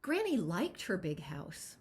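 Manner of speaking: lively picture-book read-aloud; expressive storybook narration; reading to children. Acted. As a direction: read it as if to a room of kids.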